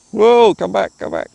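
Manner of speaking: alarmed, calling the drone back; pleading, it's drifting away; startled. Real, not acted. Drone flying off out of control.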